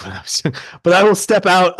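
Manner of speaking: normal talking voice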